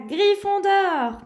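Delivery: slow, high, loud proclamation; triumphant shout of the house; hat shouting. Acted. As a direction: draw out the house name for the hall. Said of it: "Enfin, il cria".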